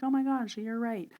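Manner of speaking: high, light imitation of awe; mock amazement. Person amazed at one or two picked-up things, mock awe. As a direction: gasp it out in a high voice.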